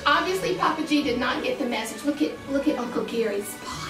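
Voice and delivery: ordinary delivery, nothing marked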